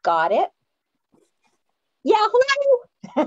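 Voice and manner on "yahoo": high, drawn-out whoop; celebratory high-pitched holler; excited cheer. Real, not acted. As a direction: let out a gleeful yahoo. Cheering as the livestream starts.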